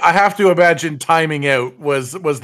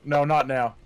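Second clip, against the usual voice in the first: ordinary talk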